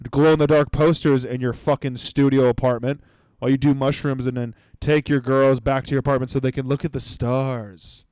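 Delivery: usual speaking voice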